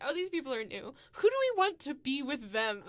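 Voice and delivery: high, loud giggly plotting voice; mock neighbors plotting new identities, high and laughing. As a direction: scheme like a prankster through laughter. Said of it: "They were just like".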